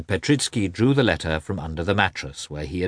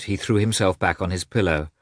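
ordinary delivery, nothing marked

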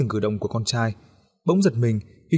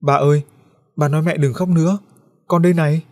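put-on child's voice urging dad, higher and louder; pleading child; 5 semitones higher, 6 dB louder than usual. A narrator's reading. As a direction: tug at dad and beg him. Ghost boy begging his father.

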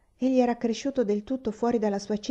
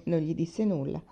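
plain reading voice

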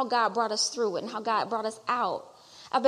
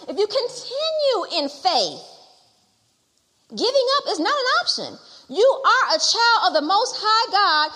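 loud, higher, fervent exhortation; preaching at full force; 5 semitones higher, 5 dB louder than usual. Real, not acted. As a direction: preach it to the congregation.

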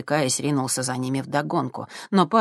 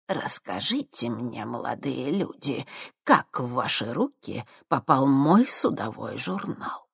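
put-on soft, slow menacing old woman; sinister crone; 4 dB quieter, slower than usual. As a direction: purr it like a sinister old witch.

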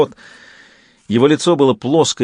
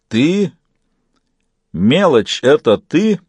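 mildly booming, voice raised in pitch; 4 semitones higher, slower than usual. A narrator's reading. Garry to the small one in the great hall, mildly booming. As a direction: let it roll out with some boom. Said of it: "Его голос гулко раскатился по залу".

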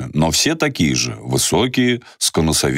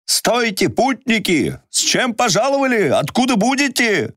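calling down. Acted. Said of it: "окрик со стены".

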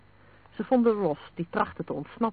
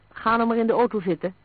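higher, louder insistent plea; firm, impatient-sounding coaxing; urging; 5 semitones higher, 6 dB louder than usual. A narrator's reading. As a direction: press them to give in. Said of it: "drong Vlaanderen aan".

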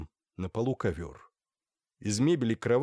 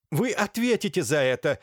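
raised, louder, heated outburst; angry threat; 7 semitones higher, 5 dB louder than usual. A narrator's reading. Laevsky threatens the doctor before lunging, angry. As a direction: fling it out as a threat.

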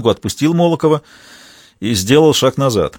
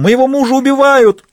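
high, loud panicked scream; screaming for help; 5 dB louder than usual. A narrator's reading. Wife screaming to the whole house, panicked loud scream. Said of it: "закричала она на весь дом".

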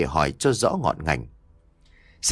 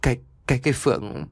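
choked up; faster than usual. A narrator's reading. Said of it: "nghẹn ngào nói".

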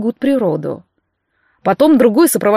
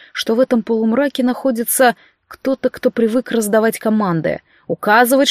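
ordinary delivery, nothing marked

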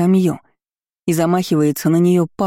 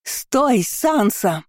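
high-pitched distant call; calling after her; 6 semitones higher, much slower than usual. A narrator's reading. Pursuers ordering the girl to stop, calling out. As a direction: cry out high, without full volume. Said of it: "кричали мне вслед".

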